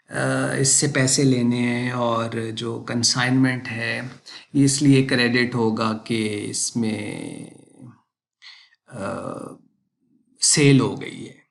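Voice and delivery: very slow, low, drawn-out words with long pauses; exaggerated slow speech. Shown as a demonstration. Teacher showing students how tedious slow teaching sounds, exaggeratedly slow. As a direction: drag every word out, stretching the fillers. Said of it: "बिल्कुल slow बोल रहा हूँ कि देखिए".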